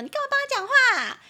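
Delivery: high, loud, fast indignant outburst; angry retort. Acted. Friend A angrily snapping at friend C. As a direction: snap back at the friend, fast. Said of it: "很生气说".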